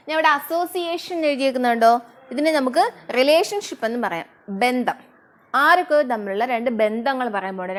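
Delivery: ordinary talk, nothing marked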